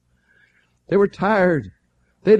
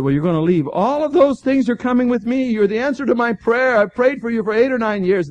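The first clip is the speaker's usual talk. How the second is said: raised, forceful retold orders; imitated insistent demand; 5 semitones higher, 6 dB louder than usual. Moody recruits the answer to his prayer, acted insistence. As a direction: press him hard, climbing in pitch and volume. Voicing Moody pressing Sankey to join.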